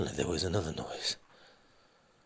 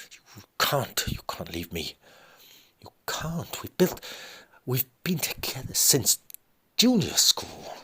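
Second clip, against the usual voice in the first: climbing much higher, breaking into a whisper; wife begs husband as he leaves; desperate pleading; 7 semitones higher than usual. Acted. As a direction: plead with rising despair.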